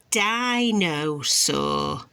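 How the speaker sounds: dinosaur said slowly in one drawn-out run; stretched-out word. Shown as a demonstration. Naming the toy for a child, word stretched out. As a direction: draw out dinosaur without breaking it up. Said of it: "you've got a".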